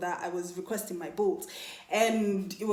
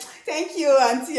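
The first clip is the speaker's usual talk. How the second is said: pointed, higher "thank you, auntie"; sassy thanks; 7 semitones higher, 8 dB louder, slower than usual. Retold or imitated. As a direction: throw the thanks with attitude. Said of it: "she gives him this look".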